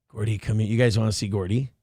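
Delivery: ordinary talk, nothing marked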